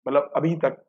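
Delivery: ordinary talk, nothing marked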